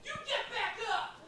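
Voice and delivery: high, softened shout of a stranger; mock distant yell. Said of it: "he starts yelling at me".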